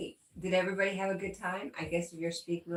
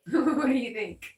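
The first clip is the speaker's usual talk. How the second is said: louder, higher, laughing through it; laughing question; 6 semitones higher, 9 dB louder than usual. Real, not acted. Streamer giggling about Mabel with a chainsaw, laughing loudly. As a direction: ask it while still cracking up.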